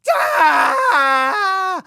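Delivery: loud, high imitated child's scream; hysterical wail. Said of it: "she's hysterical".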